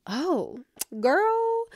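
put-on soft "oh" then a high, drawn "girl"; a weary sigh at yourself; exasperated at herself. Catching her own borderline flare, high exasperation. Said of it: "I'm like".

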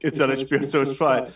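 ordinary talk

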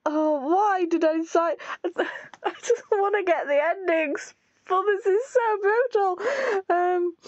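high, half-crying lament; distressed whine. Real, not acted. Player lamenting the brutal route to the endings, distressed whine. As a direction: wail it as if near tears.

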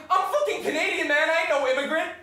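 high, loud acted protest; indignant retort. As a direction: shout back at the taunters, offended. Retorting to people shouting at him.

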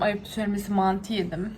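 ordinary delivery

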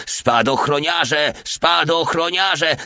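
high, drawn-out alarm cry; panicked yelling. A narrator's reading. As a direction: call the warning in terror, stretched. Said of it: "darł się w panicznym strachu". (przeciągły krzyk panicznego strachu)

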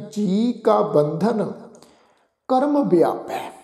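ਬੰਧਨ said whole as bandhan, slowly, each word drawn out; slow, careful scripture reading with ਬੰਧਨ kept as one word; slow recitation. Shown as a demonstration. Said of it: "ਪਾਠ ਉਚਾਰਨ ਕਰਦੇ ਹਾਂ ਜੀ".

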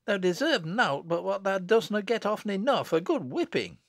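high-pitched country scolding; scolding mother. Acted. Mother threatening her child, high scolding.

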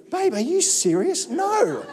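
high-pitched, incredulous comic protest; mock indignation. Husband answers wife's chocolate advice, mock indignant. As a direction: play up the disbelief, pitched well up. Retold indignant reply to his wife.

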